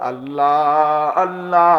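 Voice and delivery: loud, high anguished call to God; crying out. Real, not acted. Preacher crying out to God in the night, loud anguished call. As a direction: cry out his name with everything.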